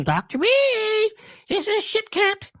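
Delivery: high, very slow call of the name; drawn-out calling. Acted. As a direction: stretch the name out high like something calling you. Chickens calling in dream.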